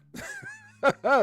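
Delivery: high, loud cackle of relief; elated laugh. Real, not acted. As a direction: burst into a high triumphant laugh. Laughing at fixing the mic.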